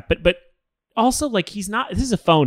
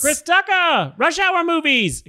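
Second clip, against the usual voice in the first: Chris Tucker impression; slower than usual. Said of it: "he says that in almost all".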